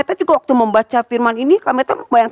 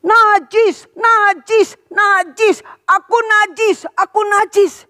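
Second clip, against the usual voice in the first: calling out unclean; 9 semitones higher, much slower than usual. Acted. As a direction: call it out so people keep away. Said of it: "mereka harus begini". (seruan peringatan keras dan tinggi)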